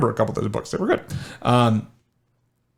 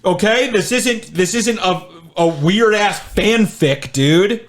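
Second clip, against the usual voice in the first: exasperated; 7 semitones higher, 4 dB louder, slower than usual. Real, not acted. Exasperated at partner's made-up lore.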